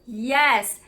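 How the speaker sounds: usual speaking voice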